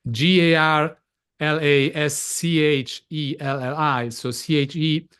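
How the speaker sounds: letter by letter, measured; spelling out. Shown as a demonstration. Guest spelling the surname for the host, spelling out. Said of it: "So it's [name]".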